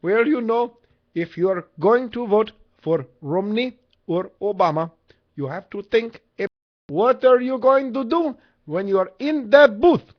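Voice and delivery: put-on high-pitched old Indian man accent; old Indian guy accent. Shown as a demonstration. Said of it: "Here's my old Indian guy accent".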